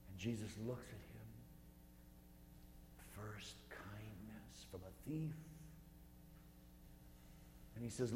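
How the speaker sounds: soft, unhurried meditation voice leading the listeners up Calvary; gently walk them through the scene; guided meditation. Acted. Priest guiding a prayer group through Calvary, in a soft meditative voice.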